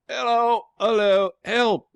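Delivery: high, loud calls for help; shouting for help. A narrator's reading. Ralph clinging to the rope, shouting for help. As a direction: cry out desperately into the dark. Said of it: "he shouted".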